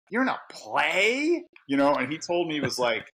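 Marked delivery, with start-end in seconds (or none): 0.1-1.4 mock disbelief. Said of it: "And I'm like".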